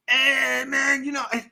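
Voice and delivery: high, strained stoner voice; Cheech. Acted. Streamer trying his best impersonation, strained Cheech voice. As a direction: attempt a high character voice that gives out. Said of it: "My best impersonation is Cheech from Cheech and Chong".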